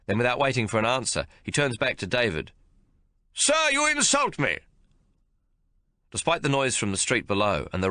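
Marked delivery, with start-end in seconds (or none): 3.3-4.6 high, loud affronted officer; outraged general; indignant general. A narrator's reading. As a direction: bristle with offended pride. Affronted general confronts David.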